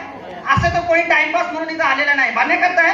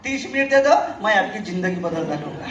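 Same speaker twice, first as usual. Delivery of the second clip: salesman's catchphrase; 6 semitones lower, 4 dB quieter than usual. Retold or imitated. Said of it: "आणि एकच dialogue त्याचा".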